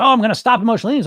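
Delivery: mimicking dieters making a confident vow; mock resolve. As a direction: rattle it off like a naive promise. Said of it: "But I think people say".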